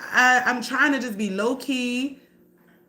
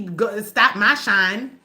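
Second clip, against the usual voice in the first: ordinary talk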